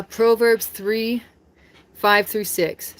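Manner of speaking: each number spaced out slowly; slow, deliberate verse citation. Shown as a demonstration. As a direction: announce the verse slowly and clearly. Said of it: "just gonna read the Bible quotes".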